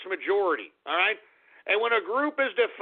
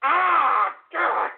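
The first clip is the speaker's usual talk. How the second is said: frustrated scream; 6 semitones higher, much slower than usual. Acted. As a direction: let it all out in a scream.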